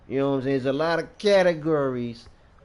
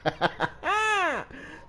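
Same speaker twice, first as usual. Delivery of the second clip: high-pitched laugh; 4 dB quieter than usual. Real, not acted. Streamer laughing at his own slogan, high cackle. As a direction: cackle rising to a high squeal.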